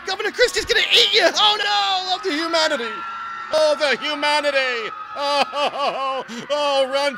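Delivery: hammed-up panic. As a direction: play the shrieking bystander warning children of a monster. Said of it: "you just ruined my bit".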